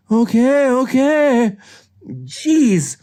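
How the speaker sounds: high, loud protest; exasperated giving in. Acted. Nick giving in to scolding.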